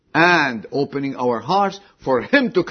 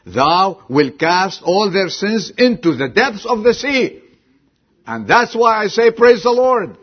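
loud, high declaration building to praise; triumphant proclamation; 9 semitones higher, 10 dB louder than usual. Retold or imitated. Believer explains praise to the questioner, triumphant loud proclamation. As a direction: proclaim it at full voice, like a jubilant believer.

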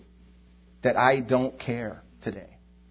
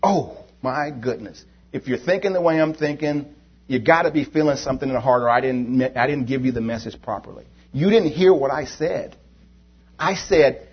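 ordinary talk

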